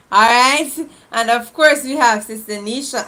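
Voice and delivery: ordinary delivery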